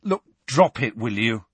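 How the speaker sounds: fed-up interruption; bursting out. A narrator's reading. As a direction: burst out impatiently. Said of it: "Harry burst out".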